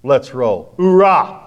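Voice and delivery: fired-up soldier ready for anything; loud gung-ho soldier. Retold or imitated. Men who shout hoorah.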